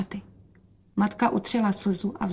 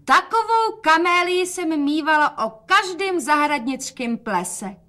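calling out; 8 semitones higher than usual. A narrator's reading. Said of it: "a volala".

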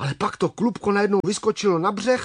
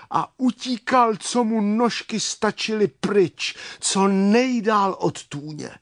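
usual speaking voice

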